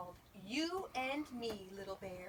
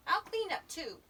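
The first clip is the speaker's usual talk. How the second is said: high little character voice; eager Little Bear; 8 semitones higher, 7 dB louder than usual. A narrator's reading. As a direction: pipe up to help as a little bear.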